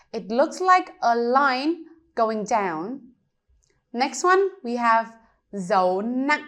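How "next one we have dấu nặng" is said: ordinary talk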